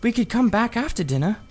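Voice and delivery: put-on high girlish voice, hushed and careful; a young girl reassuring her friend; Polly, a young girl. A narrator's reading. Polly's voice in the story.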